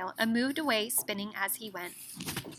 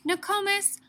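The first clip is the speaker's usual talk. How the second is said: put-on higher, curious child voice; little boy asking; 7 semitones higher, 4 dB louder than usual. A narrator's reading. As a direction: ask like a puzzled small boy. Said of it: "asked the little boy".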